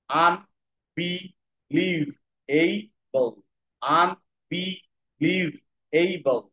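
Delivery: Unbelievable stretched out very slowly, each of five syllables apart. Shown as a demonstration. Teacher breaking a word into syllables for students, very slowly. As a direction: sound out unbelievable one syllable at a time, very slowly. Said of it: "এটা পাঁচটা syllable word".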